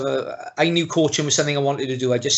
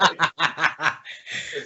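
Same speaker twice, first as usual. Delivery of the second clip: laugh; 6 dB quieter than usual. Real, not acted. Laughing at the joke.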